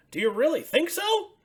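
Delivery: high, bright puppet voice for Ernie; excited high Ernie calling out. Acted. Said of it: "asked Ernie".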